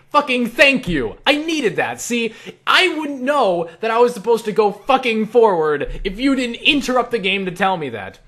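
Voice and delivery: loud, high, exasperated mock gratitude; sarcastic outrage. Player ranting at an interrupting game companion, loud sarcastic exasperation. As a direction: rant back at a useless helper.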